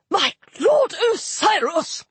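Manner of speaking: high, drawn-out scandalised cry; fussy god's indignant exclamation; outraged official. A narrator's reading. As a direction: protest to your lord, high and aghast. Flustered god protests in outrage.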